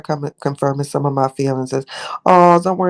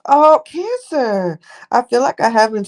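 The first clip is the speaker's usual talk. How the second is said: 1 delighted greeting; 8 semitones higher, 3 dB louder than usual. Real, not acted. Greeting a viewer warmly.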